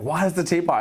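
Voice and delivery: high, loud outraged squeal; shocked disbelief. Retold or imitated. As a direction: act out the shock of losing the teapot. Retold shock, teapot already packed.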